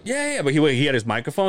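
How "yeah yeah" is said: casual agreeing. Retold or imitated. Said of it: "he's like".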